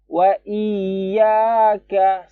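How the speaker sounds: slow, high, drawn-out chanted recitation; Quran recitation. Shown as a demonstration. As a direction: recite it slowly like tilawah. (tilawah pelan dan tinggi)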